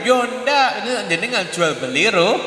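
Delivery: usual speaking voice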